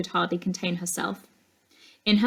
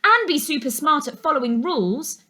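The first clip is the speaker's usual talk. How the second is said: yell; 9 semitones higher, 3 dB louder than usual. A narrator's reading. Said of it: "yelled someone else".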